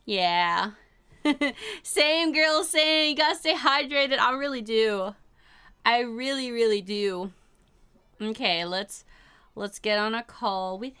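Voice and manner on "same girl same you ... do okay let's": usual speaking voice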